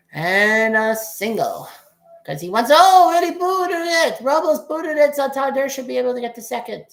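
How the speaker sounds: loud, high-pitched burst on the error; excited play-by-play. Real, not acted. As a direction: call the booted ball as it happens, voice jumping up. Live call of an error.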